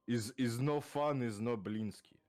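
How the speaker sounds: put-on slow, heavy Russian accent. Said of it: "you can read them for me".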